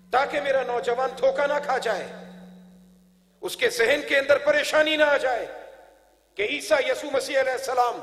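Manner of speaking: ringing sermon proclamation; preaching at full force. Real, not acted. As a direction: proclaim each line to a big congregation. Preaches to a crowd.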